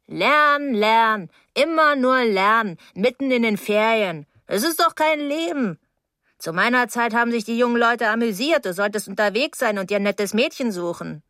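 plaintive, wheedling woman's voice with swooping pitch; complaining older neighbour. Acted. Mrs Deering pleads her bad heart, plaintive and wheedling. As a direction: wheedle to be let in, milking the ailment.

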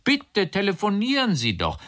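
high-pitched insistent plea; emphatic, high invitation; urging. A narrator's reading. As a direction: urge them on, pitched well up. Acted urging in a quarrel.

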